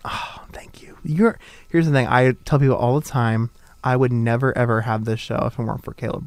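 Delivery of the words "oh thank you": voiceless, breath-only whisper; whispered thanks. Real, not acted. Host answering producer's compliment, whispered. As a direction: whisper it as if touched. Reacts to being told he's liked.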